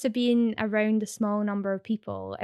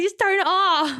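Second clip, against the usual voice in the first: higher and louder, fed-up snap; exasperated retort; 9 semitones higher, 5 dB louder than usual. Retold or imitated. Streamer answering rude commenters, fed up and exasperated. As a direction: tell the haters off, exasperated. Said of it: "I was like".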